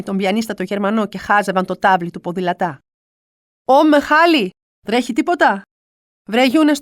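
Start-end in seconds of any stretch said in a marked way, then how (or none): 3.6-5.6 calling up. Retold or imitated. Younes calls up from street. 6.3-6.8 calling down. Retold or imitated. Mother calls down to Younes.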